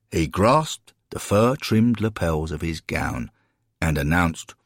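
ordinary delivery, nothing marked

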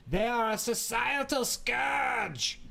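slow, high, shouted German accent. Shown as a demonstration. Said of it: "If you say it in a German accent".

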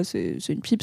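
low, quiet, know-it-all tone; a lightly voiced clueless guy; dismissive guy voice. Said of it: "des mecs, euh, qui pensent que".

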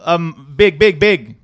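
hammed-up Chinese tailor, big big big; mock Chinese tailor accent. Host mimicking the tailor in a Chinese accent. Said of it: "do it in a Chinese accent".

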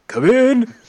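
high, loud singsong call. Acted. Dim bandit answering a knock at sea, calling out high.

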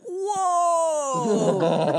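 high, drawn-out mock gasp of wonder; hammed-up astonishment; mock amazed reaction. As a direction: imitate exaggerated awe, long and high. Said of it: "all the faces are like".

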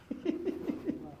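short high laugh. Real, not acted. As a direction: burst out laughing at the joke. Laugh after joke.

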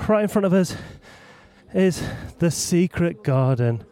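ordinary delivery

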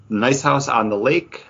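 usual speaking voice

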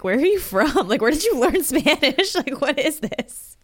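lightly voiced, laughing teasing locals; mock the formal learner, laughing; a hint of amused teasing Spaniards. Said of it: "they would tease me".

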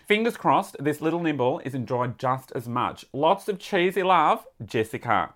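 letter read in a put-on Aussie accent; Australian accent. Podcaster reading a listener's letter on request, Australian accent. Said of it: "Please read in your best Australian accent".

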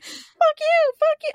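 high, loud singsong jeer; mock taunting. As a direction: imitate a bully goading someone. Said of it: "like, taunt him".

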